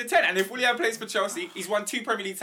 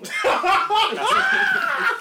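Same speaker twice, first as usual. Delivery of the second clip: laughing shout; 5 dB louder than usual. Real, not acted. Laughing outburst at Willian's shirt.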